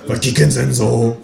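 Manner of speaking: slow, put-on neighbour voice; neighbour woman voice. A narrator's reading. Downstairs neighbour challenges the narrator, slow character voice. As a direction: drag it out as the suspicious neighbour. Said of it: "fragt sie".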